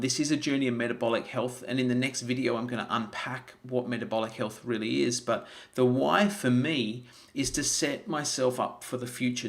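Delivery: ordinary delivery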